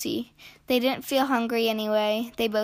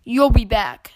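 loud, mildly angry retort; storming cat; 9 dB louder than usual. A narrator's reading. Cat calling after departing duck, mildly storming. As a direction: storm it out, pitch kept down. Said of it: "stormed the cat".

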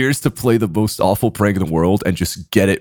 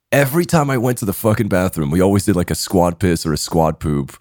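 ordinary delivery

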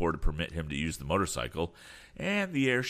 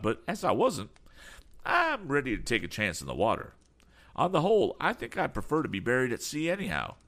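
excitable voice with high-pitched questions; eager eccentric; 9 semitones higher than usual. Acted. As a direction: ask eagerly to come along. Damon dismisses the danger.

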